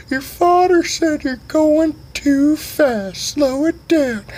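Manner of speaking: high, slow put-on voice; hammed-up high friend voice; mock high slow voice. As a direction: drag it out in a high silly voice. Friend relays dad, high slow voice.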